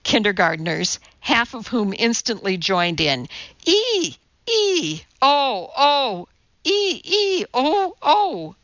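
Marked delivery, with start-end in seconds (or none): 3.6-8.5 chimpanzee yowl. A narrator's reading. Kindergartners join Tommy's ape game, chimp yowl. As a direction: alternate monkey ee and oh calls. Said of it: "yowling like a chimpanzee".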